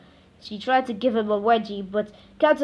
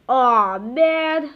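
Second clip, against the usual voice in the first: mock moan; 2 semitones higher, 6 dB louder than usual. A narrator's reading.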